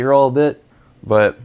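speaking around candy; licking between words; lollipop in mouth. Real, not acted. Seen 0: Lollipop held near lips, in mouth about 5.4–8.6 s.

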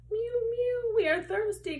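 high, loud little cat voices; mewing cats. Acted. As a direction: squeak it like thirsty kittens. Cats cry out in story.

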